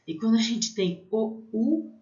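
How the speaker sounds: normal talking voice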